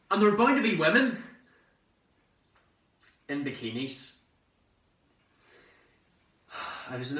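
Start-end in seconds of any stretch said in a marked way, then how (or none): 0.1-4.1 high, loud, eager burst; excited anticipation. Acted. Young man picturing three months on the Riviera, excited. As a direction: let the hope burst out.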